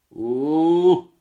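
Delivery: put-on high, very loud drunken howl; drunken roar. Drunk father howls wordlessly as he comes out. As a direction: let out a wild drunk bellow. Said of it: "zařval opilý hlas".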